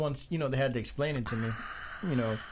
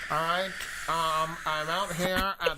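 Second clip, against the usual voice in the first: lightly voiced old-time news reporter; slow announcer-style live report; 1950s reporter voice; 5 semitones higher than usual. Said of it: "that's my reporter voice from the nineteen fifties".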